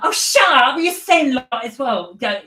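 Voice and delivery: high mock bus-kid yell; loud schoolkids. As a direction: imitate rowdy kids shouting on a bus. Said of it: "they talk so loud on the bus".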